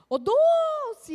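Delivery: high, loud calling yell across the room; grandma's yell. Retold or imitated. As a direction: shout the name to someone far off. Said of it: "E ela gritava". (grito alto chamando de longe)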